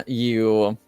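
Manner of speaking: normal talking voice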